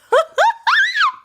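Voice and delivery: high loud squealing laugh; giddy shrieking laugh; excited squeal laugh. Real, not acted. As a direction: squeal with delight at the reveal. Excited to reveal PR box.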